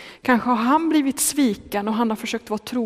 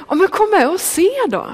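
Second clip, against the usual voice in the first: eager invitation; 7 semitones higher, 4 dB louder than usual. Acted. Filippos invites the skeptic.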